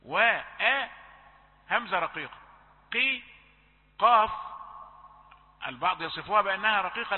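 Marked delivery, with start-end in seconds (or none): 2.9-4.3 قي then قاف, pronounced as separate sounds; isolated qaf sound. Shown as a demonstration. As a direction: drill قي and قاف apart, loud. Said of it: "قل في آخر درجات التفخيم". (نطق قي وقاف منفصلين)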